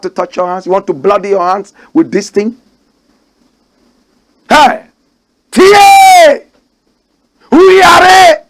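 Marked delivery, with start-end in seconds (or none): 4.5-8.4 shout. Real, not acted. Calling out in excitement.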